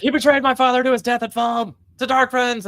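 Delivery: mock rant. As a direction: play an outraged lordling, overdone. Luke ranting about his father.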